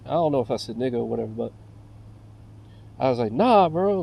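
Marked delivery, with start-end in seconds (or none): 3.3-4.0 sharp, raised "Nah, bro". Retold or imitated. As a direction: snap it back at him. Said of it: "I raised my voice".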